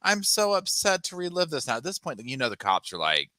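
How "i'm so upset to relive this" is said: high, whiny mocking of a fake victim; fake upset victim. Mocking the suspect acting upset for police, high whiny. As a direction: sneer at her put-on distress. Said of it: "she's acting like".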